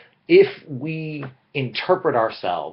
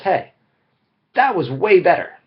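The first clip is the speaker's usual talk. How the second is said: higher, louder pleased inner voice; pleased relief; 7 semitones higher, 6 dB louder than usual. Retold or imitated. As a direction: cheer yourself on, relieved. Imagined relieved self-talk.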